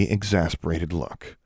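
ordinary delivery, nothing marked

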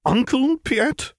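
slow, very high startled echo; taken aback, stammering. A narrator's reading. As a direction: draw it out high, as if thrown by the new title. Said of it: "stammered the newly christened addition".

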